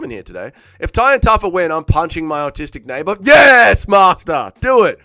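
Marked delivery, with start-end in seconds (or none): 3.2-5.0 loud, high, hammed-up obedient yell; gleefully egging someone on, pretend-fervent; mock servant's cry. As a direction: shout it like an over-eager henchman. Acts gleeful servant egging on viewer.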